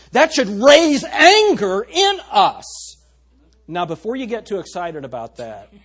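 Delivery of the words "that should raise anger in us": slow, high, booming shout; righteous anger. Real, not acted. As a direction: thunder it out to rouse the congregation. Preacher climaxes a call to anger.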